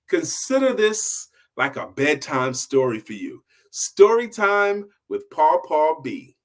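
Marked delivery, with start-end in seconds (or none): 3.7-6.3 higher, louder and slow, announcing the show name; show sign-off. Acted. Host signs off to his viewers with the show name, announcer style. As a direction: sell the show title like a jingle.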